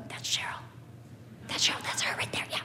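imitated furtive whispering; hushed whisper. Shopper secretly pointing out Cheryl to another, whispered. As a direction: whisper it like pointing someone out on the sly.

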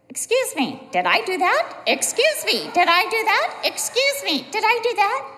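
high, singsong robot catchphrase on repeat; toy robot. Retold or imitated. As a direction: chirp it high like a looping toy. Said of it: "and we heard".

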